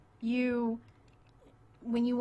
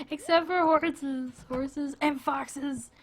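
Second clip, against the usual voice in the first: squeaky gushing; 5 semitones higher, 4 dB louder, slower than usual. Real, not acted. Playful squeal over animals.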